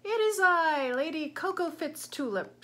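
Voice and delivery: high, loud grand lady declaring herself; grand announcement. A narrator's reading. Grand panda lady announcing herself to the shop, loud and lofty. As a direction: sweep in and announce yourself like royalty. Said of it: "she announced".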